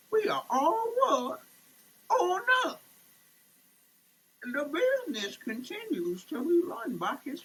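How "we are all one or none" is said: higher and louder, grand declaration; proclaiming. Pretend. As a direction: declare it like a rallying cry. Proclaiming the motto.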